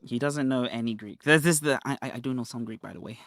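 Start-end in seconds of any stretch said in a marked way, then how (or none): 1.8-3.2 stammering mock of the opponent, dropping away soft; lightly voiced pleading imitation. Mocking the opponent's claim to know Greek, a soft whiny imitation. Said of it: "you see how much of a beg that is".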